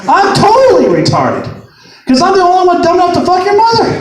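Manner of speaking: high-pitched sarcastic rant; acted-out indignant retort; triggered comeback. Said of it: "I instantly got triggered".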